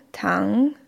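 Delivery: Tang dipping low, then rising; third tone. Shown as a demonstration. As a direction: sink Tang down and bring it back up. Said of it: "Noch einmal". (Tang im dritten Ton, fallend-steigend)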